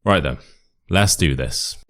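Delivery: ordinary delivery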